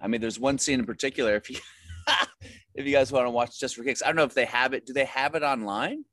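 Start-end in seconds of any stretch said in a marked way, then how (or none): 1.9-2.5 brief high-pitched laugh; sudden little laugh; short laugh. Real, not acted. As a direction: let out a quick high laugh. Laughs while recalling his scene.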